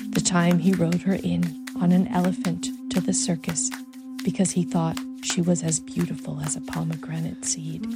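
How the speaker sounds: ordinary delivery, nothing marked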